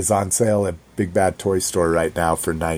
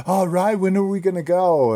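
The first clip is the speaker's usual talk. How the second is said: bright excited squeak, pitched up; eager high girl voice; 11 semitones higher, faster than usual. Retold or imitated. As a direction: chirp it like an eager kid. Girlfriend quoted asking to go.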